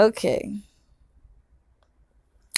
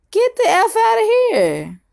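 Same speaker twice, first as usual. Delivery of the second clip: much higher, incredulous exclamation; outraged disbelief; 13 semitones higher than usual. Real, not acted. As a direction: burst out in disbelief.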